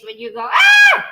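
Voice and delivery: sudden high loud "Ah!" after a strong drink; mock screech after a shot of strong rum; gasping screech. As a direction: yelp like the rum just burned your throat. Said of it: "because it was that strong".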